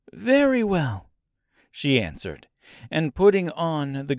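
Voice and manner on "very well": put-on high-pitched girl agreeing; Dorothy's voice.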